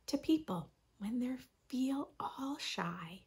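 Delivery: soft hushed whisper. Acted. Reading to kids about shy feelings, gentle whisper. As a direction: breathe it out like a secret about shyness.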